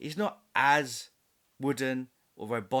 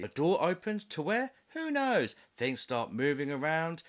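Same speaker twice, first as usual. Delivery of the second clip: ordinary talk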